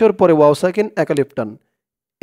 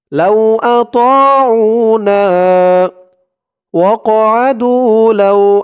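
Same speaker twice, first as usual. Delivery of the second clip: slow, higher chanted phrase; slow recitation; 5 semitones higher, 5 dB louder, much slower than usual. Shown as a demonstration. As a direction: recite it in tajweed style.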